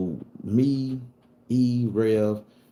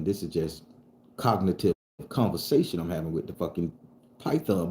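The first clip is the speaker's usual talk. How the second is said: usual speaking voice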